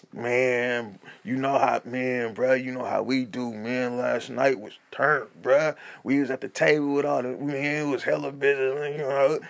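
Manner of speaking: low-voiced oversharing bro impersonation; turnt party dude. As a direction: imitate a hyped friend rambling about last night. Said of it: "you get the extra turn dude".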